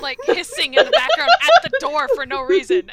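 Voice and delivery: loud, high, laughing explanation; cracking up mid-sentence. Real, not acted. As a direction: explain it through your own laughter. Cracking up over hissing cat.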